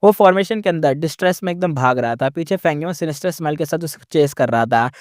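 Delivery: usual speaking voice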